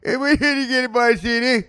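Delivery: high, loud garbled Italian-American banter. Acted. Blue-collar men interacting, high loud Italian-American accent. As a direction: yelp it in mock Italian-American street slang.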